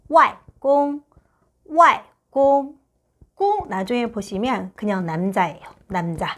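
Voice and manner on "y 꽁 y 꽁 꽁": Y falling, 꽁 high and level, slowly; Mandarin wàigōng. Shown as a demonstration. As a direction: say Y then 꽁 as wài gōng with clear tones. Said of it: "그러면 외할아버지는". (와이꽁을 4성과 1성으로 천천히)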